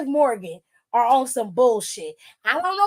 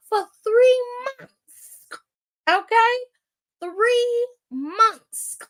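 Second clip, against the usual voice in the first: much higher, slow "three months"; outraged emphasis; 9 semitones higher, much slower than usual. Real, not acted. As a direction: hit "three" high and slow in disbelief. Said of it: "outraged emphasis".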